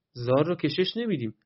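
ordinary delivery

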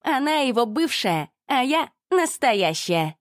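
high, slow self-satisfied brag; boastful Olga. A narrator's reading. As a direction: jab the point home proudly. Ольга кичится перед участковым. (хвастливый высокий голос)

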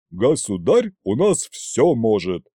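proud declaration. A narrator's reading. Loyal officer vouching for his sovereign, proud. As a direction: boast firmly on the sovereign's behalf. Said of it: "с гордостью заявил Колымдай".

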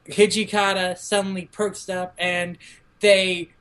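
ordinary talk

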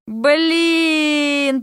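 long drawn-out groan. A narrator's reading. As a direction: groan the word out slowly. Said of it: "простонал Дерек".

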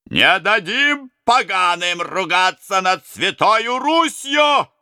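high, slow, loud battle cry; rallying shout. Acted. Robbers to the prince, slow high battle cry. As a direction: shout it as a vow.

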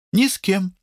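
short, high reply in a character voice; high-pitched answer. A narrator's reading. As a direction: answer quickly, pitched well up. Lata's answer, echoed by Amit.

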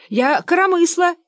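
high, insistent piping; indignant boaster. Acted. Fyodor Kuzmich boasts of inventions to his pursuers, high indignant voice. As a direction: claim it in a high voice.

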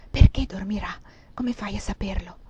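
urgent hushed questions; whisper, pressing for an answer; whispered questioning. A narrator's reading. Mistress secretly questions her maid, whispered.

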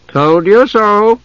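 high, drawn-out crowing; smug told-you-so. Acted. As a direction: stretch it out triumphantly. Tas gloats to the others.

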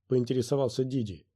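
usual speaking voice

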